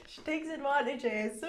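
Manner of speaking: loud, high laughing; loud laughter. Real, not acted. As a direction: laugh loudly through the line. Loud laughter after story.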